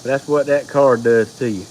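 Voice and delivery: ordinary talk, nothing marked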